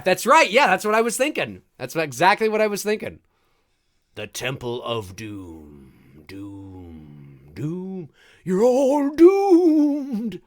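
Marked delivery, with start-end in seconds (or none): 4.2-10.4 slow doom-laden prophet voice; slow ominous raspy drawl. Retold or imitated. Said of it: "Good old Ralph".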